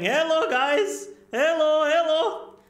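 high, slow sing-out hello; excited greeting. Real, not acted. Streamer greeting the guys watching, excited high call. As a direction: call out brightly pitched hellos, drawn out.

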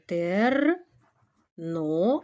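"тер, но" slowly split into syllables; measured dictation of "тер, но"; sound by sound. Shown as a demonstration. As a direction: spell out "тер, но" aloud in drawn-out pieces. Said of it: "Далі без питань".

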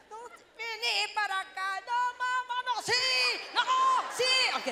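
high, loud scolding shout; mother shouts at her children to come over; mother yelling. Retold or imitated. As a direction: yell at the kids to come here now.

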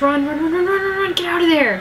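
will the characters to flee; urgent urging. Real, not acted.

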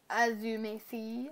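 ordinary talk, nothing marked

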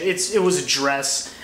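ordinary talk

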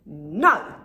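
loud firm shouted No; loud defiant shout; yell. A narrator's reading. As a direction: shout it at the wolf. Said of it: "yelled the three little pigs".

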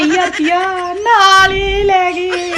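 drawn-out lament. Real, not acted. Lamenting lost pension.

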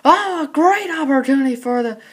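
rapid live hockey play-by-play; frantic mock sports commentary. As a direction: call the game as it happens.

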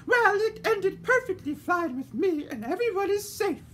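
a genteel lady fretting in the dark; voiced lady character; fussy lady. A narrator's reading. Lady Dandelion.

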